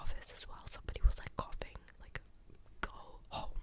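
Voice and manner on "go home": usual speaking voice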